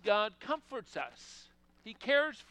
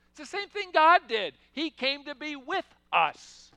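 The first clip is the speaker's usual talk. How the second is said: emphatic preaching; 6 semitones higher, 9 dB louder than usual. Real, not acted. Sermon climax about God.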